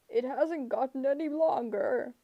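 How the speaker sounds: gruff giant voice; a lumbering brute complains; monster voice. A narrator's reading. Cyclops voice.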